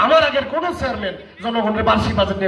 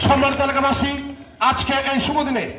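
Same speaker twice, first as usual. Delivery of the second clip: higher, formal public address; pompous mayor; 4 semitones higher than usual. Acted. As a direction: orate to the crowd like a self-important chairman. Chairman opens the budget speech.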